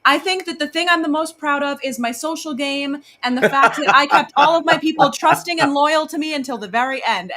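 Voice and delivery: high, loud mock-proud juror answer; Vince. Mocking his answer to the jury he burned, high, loud imitation. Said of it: "looks in the eyes ... and said".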